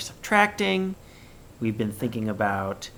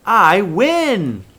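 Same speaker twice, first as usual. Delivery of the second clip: high, loud triumphant cheer; excited; 8 semitones higher, 10 dB louder than usual. Shown as a demonstration. Teacher acting a winner for the class, excited. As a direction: shout the win proudly. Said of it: "pretty excited sentence".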